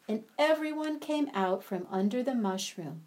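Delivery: ordinary delivery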